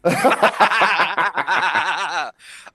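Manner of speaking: loud, long burst of laughter; real uproarious laughing fit; big belly laugh. Real, not acted. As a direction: crack up helplessly and keep going. Cracking up at own impression.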